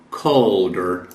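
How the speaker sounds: "Calder" sounding like "Colder". Shown as a demonstration. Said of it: "A letra A geralmente tem o som de O".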